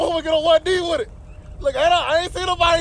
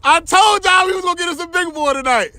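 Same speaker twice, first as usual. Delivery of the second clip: ordinary talk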